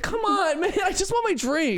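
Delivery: very high whiny customer. Pretend. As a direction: beg for the drink, exasperated. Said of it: "you're like".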